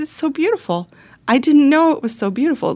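put-on high, teary voice of a moved girl; tearfully amazed, lightly acted; tearful awe. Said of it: "and she cried".

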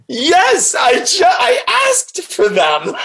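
high-pitched, laughing retold exclamation; laughing excitement. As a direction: blurt it out high, bursting into laughter. Retells her elated answer, laughing.

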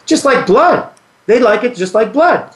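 lightly voiced buddy warning about sharks; retold friend's warning. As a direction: push the warning up high. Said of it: "He goes".

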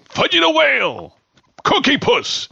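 silly, very high ad-character voice; old commercial voice. Retold or imitated. Host imitating ice cream commercials for listeners, comic high-pitched voice. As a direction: squeal the product names like a goofy TV spot. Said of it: "Remember those big commercials?".

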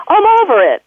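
a lightly voiced carefree dog, pitched high; voicing the dog. Vet voicing a dog that has let it go, light high carefree voice. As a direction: toss it off as a breezy dog would. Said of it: "the dog is like".

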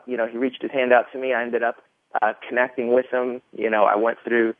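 normal talking voice

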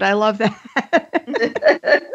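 drawn-out, slow laughing I love that; delighted laugh through the words; laughing, charmed by the accent. Real, not acted. Host laughs at guest's accent.

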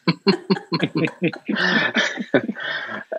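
high, light laugh trailing off; a soft high laugh of joy; delighted laugh. Retold or imitated. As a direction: let a happy laugh spill out softly. Retold tearful joyful reaction.

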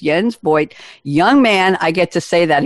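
ordinary talk

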